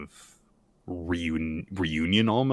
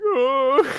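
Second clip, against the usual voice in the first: very high, loud wail; a tiny head wailing for Yoru; mock crying out; 8 dB louder than usual. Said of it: "crying out for Yoru".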